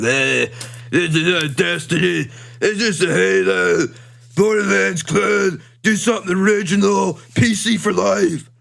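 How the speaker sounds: mock the complaining fanboy; whiny gamer. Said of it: "people out there like".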